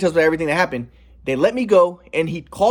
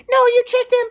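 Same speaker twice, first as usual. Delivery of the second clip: much higher, louder mocking shriek; mock screaming mom; 6 dB louder than usual. Mom yelling at her son, mocked high shriek. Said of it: "my mom was like".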